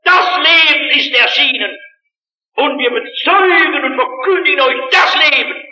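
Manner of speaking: high, loud proclaiming shout; apostles crying out. Retold or imitated. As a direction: cry it out like the apostles announcing the news. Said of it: "rufen, schreien".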